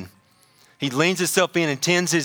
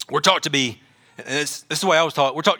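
normal talking voice

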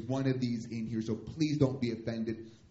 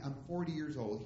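usual speaking voice

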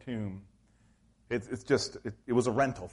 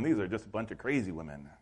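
dismissive mutter; 5 semitones lower, 6 dB quieter, faster than usual. Retold or imitated. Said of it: "they're going".

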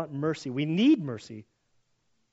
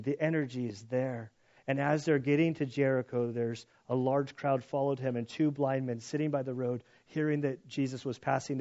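normal talking voice

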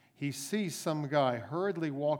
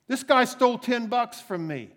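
indignant accusation; 10 semitones higher, 10 dB louder than usual. Acted. Accusing debtor to policeman.

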